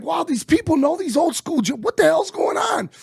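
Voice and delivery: pitched high and rising with amazement; amazed. Retold or imitated. Amazed at the DJ playing old jams, high and excited. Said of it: "And I'm like".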